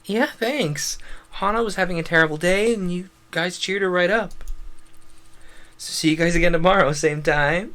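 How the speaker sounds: high, quick schoolgirl character voice; eager, high-pitched thanks and goodbye; chirpy grateful friend. Acted. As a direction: chatter brightly, high and fast.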